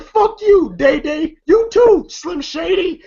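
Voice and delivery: high, punchy acted dismissal; mock angry insult. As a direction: imitate someone telling off two people in turn. Acting out Joker's insults.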